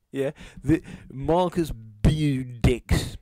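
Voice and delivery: taunting, hammed-up dismay; mock concern. Mocks her supposed wound from a tweet.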